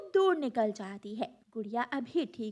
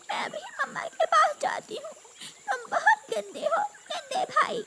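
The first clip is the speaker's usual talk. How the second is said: put-on very high, whiny child-bird voice; tearful complaint; 4 dB louder than usual. Hurt little sister to her brother, tearful complaint. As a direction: whine through acted tears, threatening to tell.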